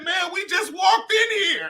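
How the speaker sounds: high, slow mock disbelief; Anthony Anderson, perplexed. Comic imitating his friend after the booing, high perplexed complaint. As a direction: plead it out, utterly baffled. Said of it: "He was so perplexed".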